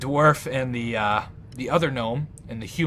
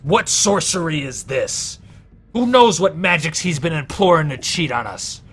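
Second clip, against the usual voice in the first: raised, higher voice, outraged gambler; indignant accusation; 4 semitones higher, 8 dB louder than usual. Acted. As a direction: cry foul at a suspected cheat, loudly. Accuser in a card-game quarrel.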